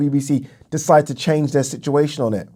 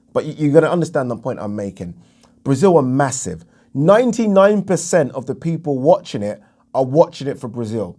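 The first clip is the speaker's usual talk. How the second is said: ordinary talk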